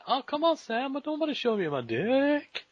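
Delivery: high falsetto mock protest; coy protest. Retelling own bashful reply to Sam, high coy falsetto. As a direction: whine it way up high, hammed-up. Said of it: "And I say".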